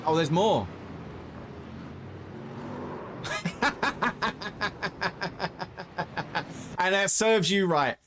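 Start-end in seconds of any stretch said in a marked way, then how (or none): 0.7-6.7 breathless, airless laughter; real long wheeze of a laugh; long silent wheezing laugh. Real, not acted. Laughing at the crash replay. 6.8-8.0 higher, louder gloating; pleased told-you-so; gleeful gloat. Real, not acted. Gloating at the crash.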